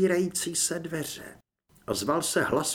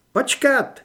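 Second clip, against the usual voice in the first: higher sharp call of "Počkat"; sudden stop; 10 semitones higher, 7 dB louder than usual. Acted. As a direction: catch everyone's attention at once. Torres náhle zastaví. (náhlé ostré „Počkat“)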